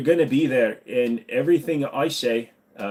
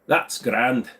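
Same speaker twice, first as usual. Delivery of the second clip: put-on Irish accent. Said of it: "We're gonna talk like this now".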